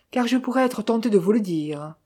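plain reading voice

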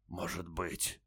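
barely audible dying rasp; faint deathbed whisper. A narrator's reading. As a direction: fade to almost nothing, dying.

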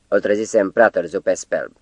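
plain reading voice

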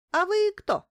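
high, loud and sharp, eyes narrowed; suspicious question. Acted. Doctor suspiciously demanding who the stranger is, high and loud. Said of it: "прищурилась на меня врач".

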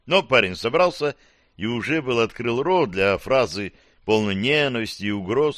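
ordinary delivery, nothing marked

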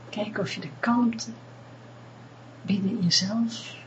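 calm them down gently, close in; guided meditation. Acted. Leads a meditation. (rustige meditatiestem)

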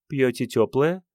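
lightly voiced woman's question, lilting up at the end; flirty blonde. A narrator's reading. Blonde addresses the man coquettishly.

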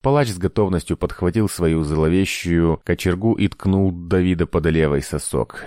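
plain reading voice

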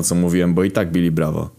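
normal talking voice

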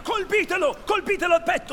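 high, loud battle yell; shouted attack order. Acted. Hook orders his pirates onto Peter, urgent shout. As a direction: yell urgently for them to strike.

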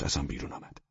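ordinary delivery

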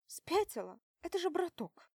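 loud alarmed disbelief. Acted. Friend alarmed.

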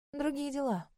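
curt and cool; dry. A narrator's reading. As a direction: dismiss it dryly. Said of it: "сухо ответила Аделина".

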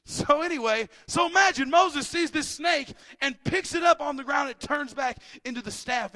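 excited sermon telling; lively preaching. Acted. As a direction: sell the snake story to the crowd. Preaches a snake story.

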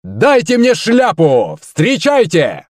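high, long-drawn announcer call; rousing stage welcome; showman's cry. A narrator's reading. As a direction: stretch the words out to summon applause. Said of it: "Он закричал".

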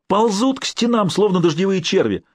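high, furious screaming insult; frenzied shout. A narrator's reading. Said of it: "исступлённо кричал хан Узбек".

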